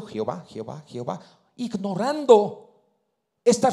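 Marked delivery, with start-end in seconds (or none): 0.0-1.2 low, soft mock chant of the name; mocking imitation. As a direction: mimic them droning the name. Imita a quienes repiten Jehová. (imitación burlona en voz baja)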